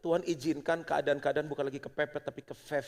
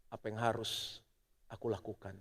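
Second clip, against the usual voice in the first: humble prayer; 4 semitones lower, 6 dB quieter than usual. Shown as a demonstration. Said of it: "kita bilang sama Tuhan".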